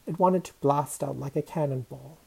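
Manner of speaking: ordinary delivery, nothing marked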